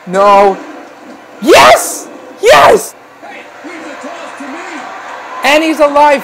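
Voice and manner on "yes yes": two high, punchy exclamations; elated cheer. Real, not acted. Commentator sees Jax is alive, elated cheer. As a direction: cheer the narrow escape.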